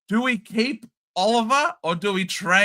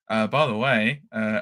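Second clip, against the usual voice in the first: usual speaking voice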